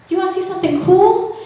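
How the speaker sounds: a hint of high, fast eager date; mock creepy offer. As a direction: tease him lightly as he offers. Quoting his creepy offer.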